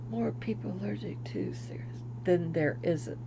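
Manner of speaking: half-whispered correction to herself; whispered self-correction; whispered aside. Real, not acted. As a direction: mumble it as you fix the sentence.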